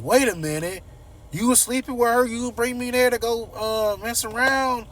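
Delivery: high and loud, outraged questioning; outraged Martin. Retold or imitated. Son confronting his father about the affair, high outraged voice. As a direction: demand answers, scandalized.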